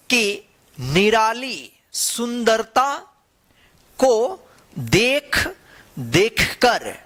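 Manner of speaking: slow story reading, one word at a time; reading to children. Acted. Teacher reads the story to children, slow and word by word. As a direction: read it out word by word so kids follow.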